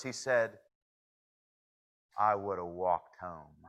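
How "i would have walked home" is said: low, flat-toned punchline delivery; deadpan. Acted. The cowboy answering the locals, low and deadpan. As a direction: drop it low and dry.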